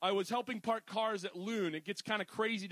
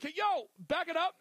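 calling out; 8 semitones higher than usual. Retold or imitated. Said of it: "I'm like".

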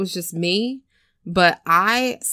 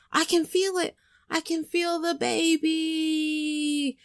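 high, drawn-out mock moan of excitement; excited film character; 9 semitones higher, 4 dB quieter, slower than usual.

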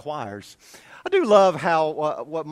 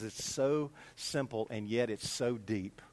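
slow, soft, low retelling; quiet sincere wonder; 7 semitones lower, 10 dB quieter, slower than usual. Retold or imitated. Church member marveling at his favorite verse, quiet and sincere. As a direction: linger softly on each phrase. Friend's answer continued.

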